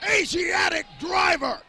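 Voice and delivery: loud, slow drawn-out move call; shouted call. Real, not acted. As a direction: belt out the finisher.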